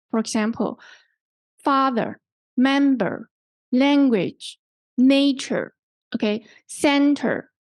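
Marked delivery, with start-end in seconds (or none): 1.6-7.5 "father, member, language, nature" each stressed on its first syllable. Shown as a demonstration. Teacher giving word stress examples, first syllables of "father, member, language, nature" stressed. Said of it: "the first syllable is stressed".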